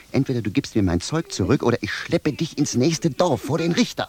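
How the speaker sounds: rising to a high yell on Richter; angry threat. Acted. Shoemaker threatens to drag philosopher to judge, rising angry yell. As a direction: threaten him, climbing to a shout.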